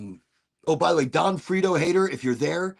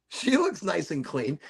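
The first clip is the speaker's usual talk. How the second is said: playful put-on voice; 2 semitones higher than usual.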